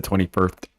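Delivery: "twenty-first" with th for the s; lisped "twenty-first". Retold or imitated. Reader mocks their own slip, lisping "twenty-first". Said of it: "like, a lisp?".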